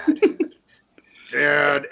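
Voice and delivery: mock mortified "Dad, Dad" pleading; embarrassed daughter. Daughter embarrassed by her haggling dad, mock mortified plea. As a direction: hiss it like a teen ashamed of her father. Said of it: "this girl is embarrassed".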